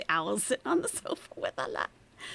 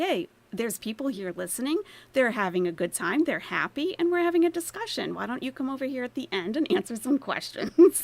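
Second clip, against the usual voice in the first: louder, brisk imitation of a savvy host; imitating Alat; 5 semitones higher, 5 dB louder, faster than usual. Imitating a host telling a guest to wait: brisk, loud. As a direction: play a savvy host gently redirecting. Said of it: "Alat knows enough to be like".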